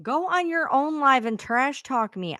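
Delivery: normal talking voice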